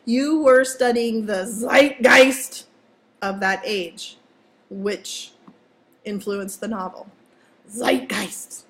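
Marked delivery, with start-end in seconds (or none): 1.4-2.6 loud, stretched mock-German pronunciation; doofy German accent. Shown as a demonstration. Teacher applying the word to Gatsby, doofy German accent. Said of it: "a doofy German accent". 7.7-8.6 goofy German-accented word; doofy German accent. Shown as a demonstration. Teacher tempting students to say it, doofy German accent. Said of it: "a doofy German accent".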